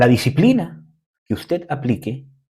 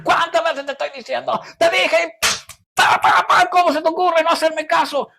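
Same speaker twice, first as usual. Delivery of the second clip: angry parent shout. Retold or imitated. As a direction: yell at the son, losing your temper. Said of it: "Pero yo no puedo decir".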